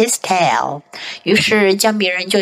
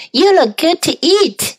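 high voice; 7 semitones higher than usual. Shown as a demonstration. Practice take.

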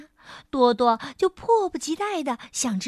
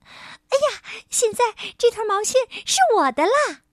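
high, quick, gleeful little mouse; thrilled mouse claiming a prize; excited squeak; 10 semitones higher, 4 dB louder than usual. A narrator's reading. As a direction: squeal it out with delight. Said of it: "兴奋地吱吱叫".

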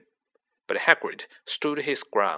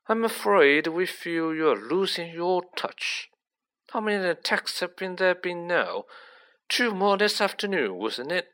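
higher, smooth Malfoy-type schemer; higher-pitched, politely condescending official; suave menacing villain; 6 semitones higher than usual. Acted.

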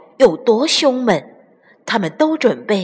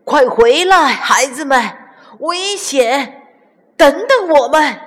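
high, urgent calling out; loud, raised warning cry; shouting after them; 7 semitones higher, 5 dB louder than usual. A narrator's reading. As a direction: call the children back from danger. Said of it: "只好大声喊道".

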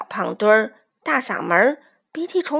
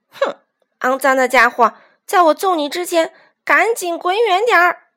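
loud, raised, indignant threat; angry shout; 6 semitones higher, 6 dB louder than usual. A narrator's reading. Chubby young rooster warning off the weasel, angry shout. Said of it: "小胖墩儿愤怒地喊道".

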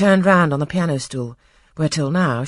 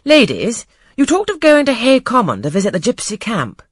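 high, louder call to the ladies; speaking aloud; 9 semitones higher, 8 dB louder than usual. A narrator's reading. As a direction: raise the voice to address the room. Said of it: "And speaking aloud".

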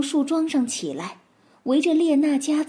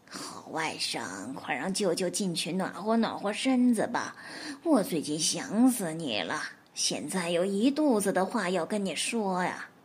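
whining beggar wolf; 5 semitones lower, 6 dB quieter than usual. Acted. Wolf begging the nephew to let him in, low whine. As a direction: plead to be let in like a starving beggar.

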